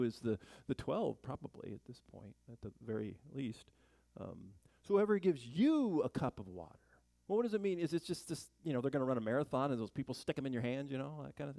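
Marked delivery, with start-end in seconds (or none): 4.8-6.8 you hit higher and louder; stress on you. Shown as a demonstration. Pastor rereading verse, stress on you. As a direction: punch the word you. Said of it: "rereading verse with emphasis".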